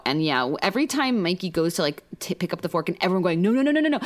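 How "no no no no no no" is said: rapid high string of protests; mock panic. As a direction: imitate a table's alarmed pleading. Everyone panicking as fork is lifted.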